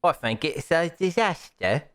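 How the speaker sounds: high bad British accent. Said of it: "doesn't know how to do a British accent".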